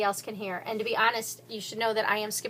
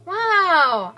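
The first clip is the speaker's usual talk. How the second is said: loud, high amazed wow; really impressed; 8 semitones higher, 13 dB louder than usual. Acted. Zach to the gunmen, loudly impressed. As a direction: gasp it out, genuinely wowed. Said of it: "I say, really impressed".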